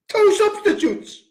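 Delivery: high incredulous repeat; outraged repeat. Real, not acted. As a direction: spit it back in outrage.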